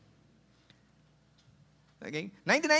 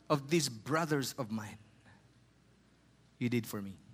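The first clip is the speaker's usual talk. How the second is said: solemn scripture reading; 8 semitones lower, 6 dB quieter than usual. Acted. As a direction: read the king's words gently, like holy text. Reads Jesus' words from scripture.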